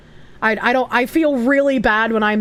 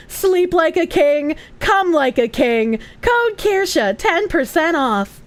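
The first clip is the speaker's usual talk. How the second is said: slow, higher-pitched ad read; sponsor shill voice; 4 semitones higher, slower than usual. Acted. Streamer pitching supplements to viewers, drawn-out sponsor voice. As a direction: sell it slowly, stretching out each phrase. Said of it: "switch gears and just be like".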